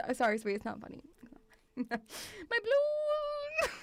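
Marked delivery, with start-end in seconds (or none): 1.8-3.7 long, drawn-out high mock wail; wailing kid. Kid losing a balloon while chased, hammed-up wail. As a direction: stretch it into a slow, high childish howl. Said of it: "picturing a kid running with a balloon".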